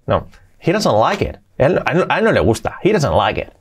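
usual speaking voice

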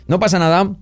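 ordinary delivery

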